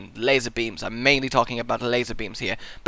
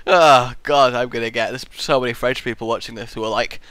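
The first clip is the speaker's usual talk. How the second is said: ordinary talk, nothing marked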